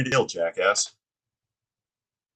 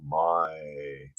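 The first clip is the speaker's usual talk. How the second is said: ordinary talk, nothing marked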